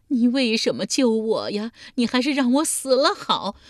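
loud and high, howling in despair; wailing sob. Acted. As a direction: cry out as if you would rather die. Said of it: "嚎啕大哭道". (嚎啕大哭地喊)

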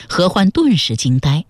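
plain reading voice

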